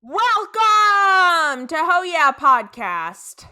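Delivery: big, loud, drawn-out welcome; show opener. Pretend. Host welcoming listeners to Ho Yeah Podcast, grand announcer opening. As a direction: belt it out slowly and high, stretching the words.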